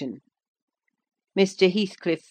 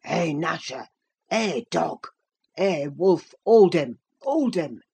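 mild dialect calls, building in force; old servant calling dogs. Acted. Old servant setting dogs on the fleeing visitor, calling. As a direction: urge the dogs on, restrained.

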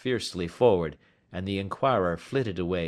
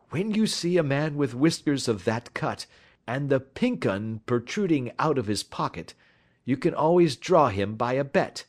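higher-pitched, lively Holmes explaining his trick; self-assured detective; 6 semitones higher than usual. A narrator's reading. Detective explaining the bet trick to his companion, self-assured. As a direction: speak as the confident sleuth.

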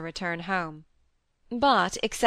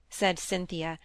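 ordinary delivery

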